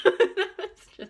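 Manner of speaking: words break up in high loud laughter; laughing hard. Real, not acted. Retelling the piercer's joke to viewers, laughing hard.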